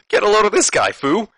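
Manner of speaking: high, loud acted-out ridicule; mocking jeer. Al Snow points out Saturn to Funaki, mocking jeer. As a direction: call it out high and loud, making fun.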